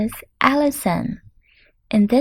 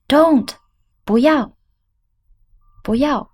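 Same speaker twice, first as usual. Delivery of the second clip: higher, louder, pleading shout; urgent plea; 6 semitones higher, 4 dB louder than usual. Retold or imitated. Pleading with a jumper on a building, urgent shout. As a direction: cry out to stop someone on a ledge. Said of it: "and you say".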